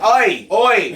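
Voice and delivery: a hint of an Australian accent, lightly voiced; mock Australian ranger calling out. Australian accent, park ranger correcting tourists playfully. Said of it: "came from Australia all of a sudden".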